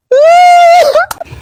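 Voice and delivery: high, loud burst of laughter; loud cackle. Acted. Friend laughing at her own CPR joke, loud cackle. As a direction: crack up hard.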